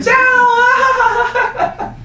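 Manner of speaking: very high, drawn-out goodbye; shouted reply. Retold or imitated. Answering his cousin at the airport, high mock shout. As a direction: imitate your own over-pitched farewell yell. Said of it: "And I was like".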